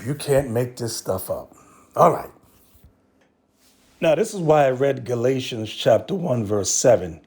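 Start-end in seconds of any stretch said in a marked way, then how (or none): none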